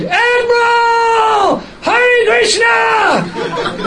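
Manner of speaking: high, drawn-out yell across a crowd; screamed call. Retold or imitated. Devotee calling to the admiral across the parade, a long high shout. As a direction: shout it out long to get his attention. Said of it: "I, I screamed".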